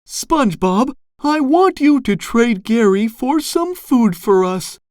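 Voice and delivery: high-pitched, insistent mother; Missus Puff. A narrator's reading. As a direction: instruct the son in a high voice. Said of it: "Missus Puff continued".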